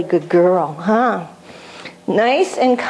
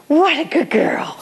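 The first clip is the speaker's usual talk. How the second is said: trainer calms the praise to a whisper; 6 semitones higher than usual. Shown as a demonstration. Quiet praise to puppy.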